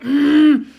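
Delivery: loud tight hum through the nose; angry nose-flaring hum. Shown as a demonstration. Teacher showing kids an angry face, loud hum. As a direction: huff like a cross little kid. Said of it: "you kind of flare your nose and you're like".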